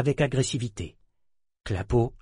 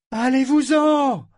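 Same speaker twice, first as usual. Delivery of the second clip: slow, much higher, loud shout; piercing command; 14 semitones higher, 6 dB louder, slower than usual. Acted. Ambre ordering the pirates off the ship, piercing shout. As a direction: cut through the wind with it. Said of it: "La voix d'Ambre perça dans le vent".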